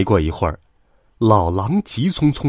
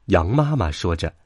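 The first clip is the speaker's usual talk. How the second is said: plain reading voice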